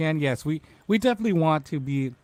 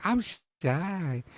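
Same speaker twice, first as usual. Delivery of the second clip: imitating sweet sister, a soft, higher shy voice; 4 semitones higher, 6 dB quieter than usual. Host imitating his sister refusing the show, shyly. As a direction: put on a small, quiet bashful voice. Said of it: "even though she'd be like".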